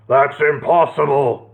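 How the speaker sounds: lightly blustering knight. Acted. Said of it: "blustered Horace".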